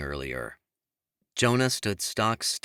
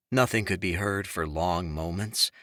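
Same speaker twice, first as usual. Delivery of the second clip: usual speaking voice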